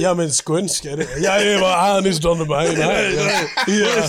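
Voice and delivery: hammed-up Scanian accent; skånska. Showing off for friends, in mock skånska. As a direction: put on broad comic skånska through the laughter. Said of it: "vad heter det, skånska?".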